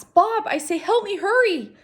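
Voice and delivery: high, loud urgent plea; urgent call. A narrator's reading. Ivan calls urgently for help.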